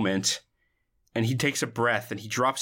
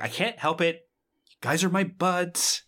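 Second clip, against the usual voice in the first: whiny Ahmed; 6 semitones higher than usual. Retold or imitated. Ahmed quote, joking.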